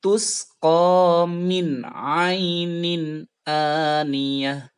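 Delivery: very slow chant with thin hamzah; slow tajwid chant; Quran recitation. Shown as a demonstration. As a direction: sing it slowly, hamzah light. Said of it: "Sekali lagi".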